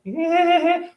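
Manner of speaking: high, put-on indifferent "eh"; squeaky mock indifference; mock shrug. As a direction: toss off a careless "eh" like a slacker date. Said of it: "someone that's just kind of like".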